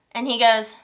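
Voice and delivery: ordinary delivery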